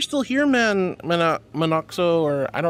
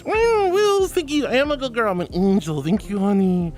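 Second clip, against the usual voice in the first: cutesy thanks; 6 semitones higher, 4 dB louder than usual. Real, not acted.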